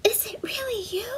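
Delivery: can't believe her eyes; mother reindeer seeing her lost daughter, whispering in awe; hushed disbelief. Acted. Mama's amazed quiet question.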